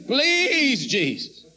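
desperate pleading prayer. Retold or imitated. Retelling his prayer, a man begging Jesus for protection. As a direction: beg Jesus like your heart is about to break. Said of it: "I prayed one of them kind of prayers".

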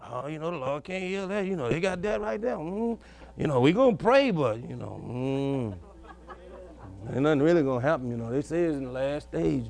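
low, soft, mumbling voice of doubt; doubting believer. Retold or imitated. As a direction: sigh it out as the skeptic in the pew. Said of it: "you'll be sitting there fighting with unbelief".

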